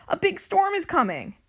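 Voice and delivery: high-pitched urgent call; alarmed shout. A narrator's reading. First mate warning of a big storm, high urgent call. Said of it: "shouts First Mate Callie".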